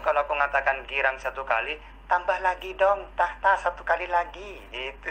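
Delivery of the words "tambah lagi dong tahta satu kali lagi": normal talking voice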